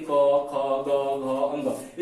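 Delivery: क, ख, ग, घ sounded in an even series; throat sounds. Shown as a demonstration. Teacher demonstrating for students, throat letters sounded out. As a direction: recite क, ख, ग, घ from the throat. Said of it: "कंठ से हो रहा है".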